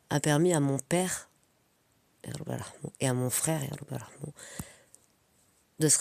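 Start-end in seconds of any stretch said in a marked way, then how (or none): 2.2-2.9 very soft, barely voiced aside; invocation murmurée. Real, not acted. Invocation en aparté pour le défunt. 3.6-4.3 very soft, barely voiced aside; invocation murmurée. Real, not acted. Invocation en aparté pour le défunt.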